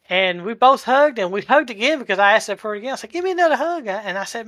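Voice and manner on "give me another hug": high-pitched, overjoyed quoted request; retold joyful plea. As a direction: relive asking a friend for a hug, voice lifted high. Retells joyful request for a hug.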